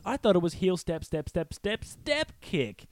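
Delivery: sing-song little cub protesting; high child voice. Retold or imitated. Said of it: "one of the babies goes".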